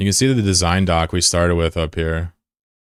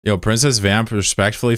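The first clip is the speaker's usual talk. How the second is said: normal talking voice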